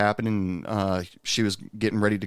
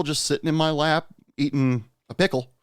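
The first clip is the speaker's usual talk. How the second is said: usual speaking voice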